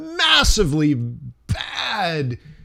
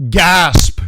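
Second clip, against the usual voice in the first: a loud, sudden mock gasp of the room; 6 dB louder than usual. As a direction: gasp as if the whole room is shocked. Retelling the room's reaction.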